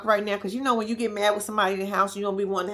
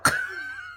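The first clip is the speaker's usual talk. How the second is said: high shrieking laugh; 5 dB quieter than usual. Real, not acted. Laughs at Sean's reaction.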